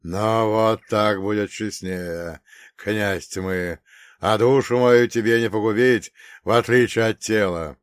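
slow, then loud defiant monk; defiant priest. Acted. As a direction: stand firm against the devil, loud. Монах обличает князя тьмы. (непокорный монах, громко)

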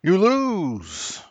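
high, singsong taunting. Pretend. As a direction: gloat it high. Taunting friend over bet.

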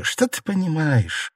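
slow, high, rueful question; bitter smile. A narrator's reading. Ailing mistress dismisses her maid, smiling bitterly. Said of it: "горько улыбнулась Фэнзе".

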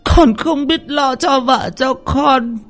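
put-on high old woman's scolding wail; old mother berating son. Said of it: "bà cụ giằng tay". (bà cụ mắng con, giọng cao the thé)

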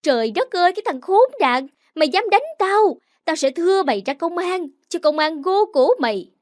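put-on old neighbour screaming after being hit. A narrator's reading. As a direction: scream it out in outrage. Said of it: "vừa hét toáng lên".

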